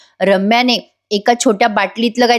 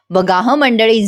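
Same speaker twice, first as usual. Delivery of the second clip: normal talking voice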